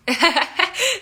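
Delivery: high, loud burst of laughter; tickled, spontaneous laughing; delighted laugh. Real, not acted. Amused by compliment on accent.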